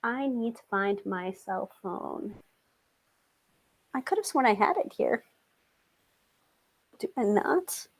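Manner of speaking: soft, absent-minded searching; talking to oneself. Real, not acted. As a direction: murmur it off-mic while hunting for something. Searching for phone, talking to herself.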